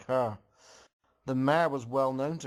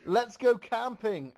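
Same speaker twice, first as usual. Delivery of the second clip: yelled suggestion; 8 semitones higher than usual. Acted. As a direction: shout the idea to the whole group. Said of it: "Applejack yelled out".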